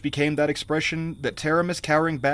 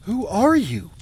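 startled, higher-pitched queen; high, swooping cry of shock; gasped question; 7 semitones higher, 4 dB louder, slower than usual. A narrator's reading. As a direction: gasp it in alarm. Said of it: "gasped Taramis".

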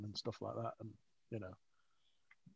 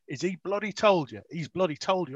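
higher, louder imitated exasperation; indignant boss; 6 semitones higher, 9 dB louder than usual. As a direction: re-enact an irritated boss demanding answers.

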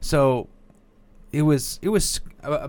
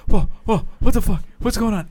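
high, loud stammering alarm; startled; 10 semitones higher, 8 dB louder than usual. Retold or imitated. Wife woken by screaming husband, startled. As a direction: blurt it out, jolted from sleep. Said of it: "I startled her out of bed".

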